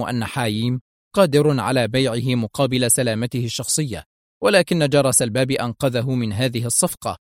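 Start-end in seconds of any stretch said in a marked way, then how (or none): none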